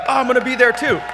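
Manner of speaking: high, loud excited quote of the Boston guy. Retold or imitated. Retelling a Boston fan's excited reply, shouted high. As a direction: belt out the guy's excited reply. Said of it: "And he's like".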